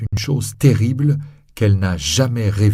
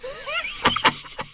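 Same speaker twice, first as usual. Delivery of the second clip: sneering high cackle; Javert's mocking laugh; cruel laugh; 5 dB quieter than usual. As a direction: laugh with bared teeth. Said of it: "Javert rit".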